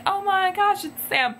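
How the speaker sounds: high-pitched squeal of recognition; fan-girl excitement. Retold or imitated. Her younger fan self spotting Sam, squealing high. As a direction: gush like a starstruck teen fan. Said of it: "twenty thirteen me was like".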